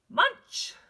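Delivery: exclaimed "munch.". Shown as a demonstration. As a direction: punch out "munch." with a loud, high rise. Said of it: "they want you to say that a little bit louder".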